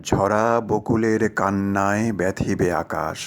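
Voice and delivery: poem recitation. Acted. Speaker opens a talk by quoting verse, slow poetic recitation. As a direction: recite it slowly, drawing out each line.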